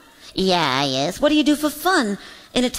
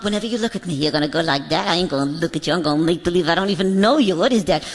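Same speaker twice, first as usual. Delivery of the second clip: low-voiced comic bear; low, grumbling stage bear banter; bear character; 7 semitones lower, faster than usual. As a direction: play a bear, pitched well down.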